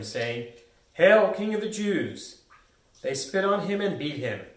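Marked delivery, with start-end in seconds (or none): 0.9-2.4 slow, loud, higher mock hail. Soldiers to Jesus in his crown of thorns, mocking. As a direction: hail him with exaggerated, drawn-out false honour. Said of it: "mocked him".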